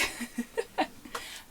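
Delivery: short unvoiced laugh; airy rising chuckle; breathless laugh. Real, not acted. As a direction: laugh off the rant. Laughs after ending rant.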